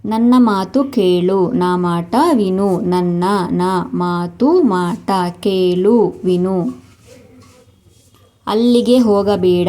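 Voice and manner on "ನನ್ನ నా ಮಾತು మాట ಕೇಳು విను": slow, each word set apart; teacher's slow drill. Shown as a demonstration. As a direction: spell it out slowly for the learner. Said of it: "slow word-by-word repeat".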